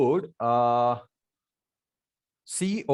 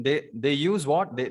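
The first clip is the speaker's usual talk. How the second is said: ordinary talk, nothing marked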